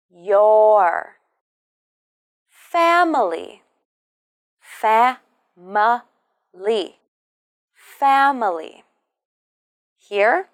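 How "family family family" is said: "Family" with the weight on "Fa"; FA-mi-ly, first beat strong; stress first syllable. Shown as a demonstration. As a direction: hit "Fa", let the rest fall. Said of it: "we stress the first syllable".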